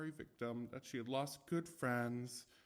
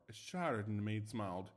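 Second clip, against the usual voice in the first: ordinary delivery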